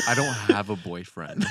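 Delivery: exaggerated masculine voice. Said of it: "That's me exaggerated".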